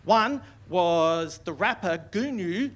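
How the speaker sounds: normal talking voice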